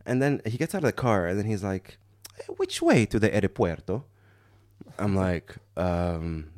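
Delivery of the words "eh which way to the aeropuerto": put-on comic Italian-accented question. Lost driver asking for the airport, comic Italian accent. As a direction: ask like a cartoon Italian. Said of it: "the comically stereotypical accent".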